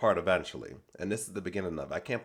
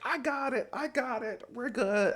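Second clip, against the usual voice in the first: much higher mocking singsong. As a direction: parrot her in a high mocking voice. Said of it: "[name] starts mocking Joellen".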